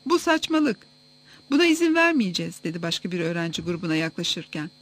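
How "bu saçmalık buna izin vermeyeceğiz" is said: high-pitched narrated protest; restrained outcry of defiance; moderate shout. A narrator's reading. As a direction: object indignantly with raised pitch. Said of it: "bağırmaya başladı".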